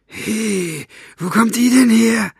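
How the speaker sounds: high, loud villain's exclamation; startled question. Acted. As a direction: demand to know, taken aback. Basta surprised by the fairy.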